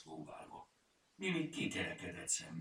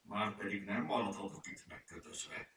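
grumbling. A narrator's reading. Furmány stuck tied to the barrier, grumbling. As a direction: grumble it with restrained annoyance. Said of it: "morogta a furmány".

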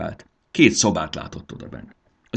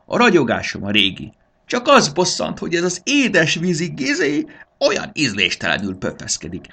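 haughty, high lady's voice, rising to an indignant squeal; a vain pearl dame scoffing at a rival; snobbish pearl lady; 8 semitones higher, 6 dB louder than usual. A narrator's reading. (kényes, magas úrihölgy-hang, felháborodottan felcsúszva)

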